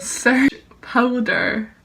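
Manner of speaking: slow, careful command; repeating to voice assistant. Real, not acted. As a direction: spell it out slowly for the assistant. Repeats after misheard command.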